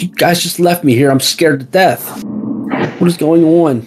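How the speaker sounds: high, frightened rush of questions; scared plea. Acted. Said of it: "and she says".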